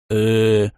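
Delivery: low-key drawn-out hum; hesitant mumbled "er". A narrator's reading. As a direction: stall with a long, level "uh". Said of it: "промычал Сергей что-то маловразумительное".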